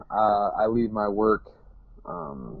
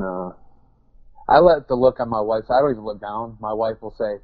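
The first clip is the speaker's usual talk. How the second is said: ordinary delivery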